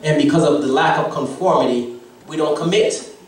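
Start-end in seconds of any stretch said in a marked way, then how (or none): none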